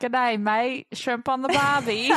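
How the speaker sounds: hammed-up Australian "Good day, mate"; joking Australian accent; mock Aussie accent. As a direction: play a cartoon Aussie at a barbecue. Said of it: "At least it wasn't Aussie".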